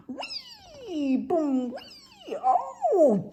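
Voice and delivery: squealed high "whee" with bumps; gleeful ride noises. Acted. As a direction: play a bouncy ride in high swoops. Bear riding on a duck's back.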